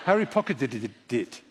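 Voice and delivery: "pocketed it" running on into did-did-dit; failed tongue-twister, extra syllables on "pocketed it"; tongue-tied stumble. Shown as a demonstration. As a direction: trip over the end of "pocketed it" with stuttered d's. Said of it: "See, I still can't say it".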